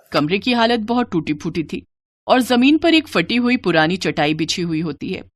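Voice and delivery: ordinary delivery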